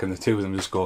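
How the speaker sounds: ordinary talk, nothing marked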